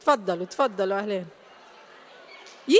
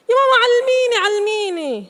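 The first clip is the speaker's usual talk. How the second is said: put-on much higher, louder whiny kid begging mom; child whining to her mother to teach her; pleading child; 7 semitones higher, 4 dB louder than usual. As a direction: beg like a little kid pulling at mom's sleeve.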